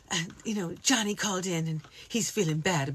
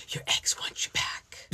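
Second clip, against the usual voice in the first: breathy radio ad whisper; 5 semitones lower, 5 dB quieter than usual. Pretend. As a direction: whisper like a late-night station. Mock radio station whisper.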